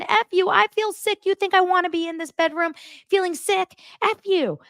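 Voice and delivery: very high, screechy mock tirade; hammed-up angry wife shriek; imitated furious outburst. Acts out wife's furious outburst.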